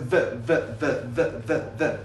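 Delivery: THE repeated with a buzzing voiced th; voiced th demo. Shown as a demonstration. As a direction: drill THE over and over, tongue between teeth. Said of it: "صدای ز".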